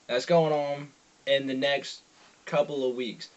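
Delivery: normal talking voice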